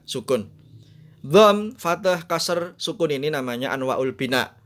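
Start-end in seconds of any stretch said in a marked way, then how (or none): 1.3-3.1 "Dham, fathah" said slowly and loudly, each word stretched out; slow emphatic listing. Shown as a demonstration. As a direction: lay the names out slowly and forcefully like a teacher. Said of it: "listing vowel names slowly". (ucapkan "Dham, fathah" dengan pelan dan keras, setiap kata dipanjangkan)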